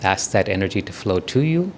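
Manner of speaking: softening, spaced-out phrases with long pauses; guided meditation. Real, not acted. Teacher asks for divine beauty to flow, quiet guided meditation. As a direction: guide them quietly, letting each phrase settle.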